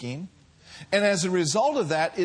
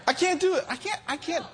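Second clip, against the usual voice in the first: very high, squeaky protest; high-pitched exclamation; 12 semitones higher, faster than usual. Real, not acted. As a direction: squeal it out in helplessness. Exclaims helplessness without God.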